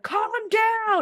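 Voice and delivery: high, loud shout calling down; mock shout down a shaft. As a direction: yell it up high down the shaft at him. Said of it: "just shouting down the elevator shaft at you".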